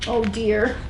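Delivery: usual speaking voice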